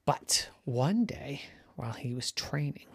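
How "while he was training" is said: plain reading voice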